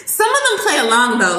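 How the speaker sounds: high laugh into the words. Real, not acted. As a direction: laugh it out, then speak. Laughing about kids' reaction.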